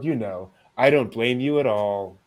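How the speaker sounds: ordinary delivery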